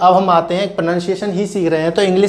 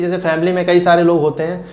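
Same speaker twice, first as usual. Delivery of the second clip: usual speaking voice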